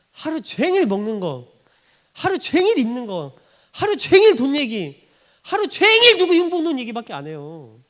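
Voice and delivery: mounting pulpit crescendo; building rebuke. Acted. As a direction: build each phrase until it peaks loud and high. List building to a climax.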